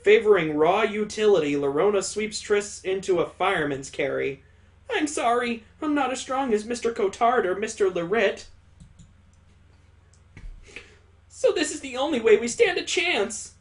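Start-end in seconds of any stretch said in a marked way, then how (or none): 4.9-13.5 higher-pitched apologetic character voice. Acted. Apologising to a teammate for lacking strength, apologetic.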